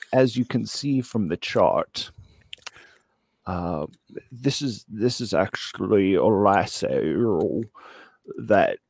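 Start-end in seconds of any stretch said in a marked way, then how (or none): none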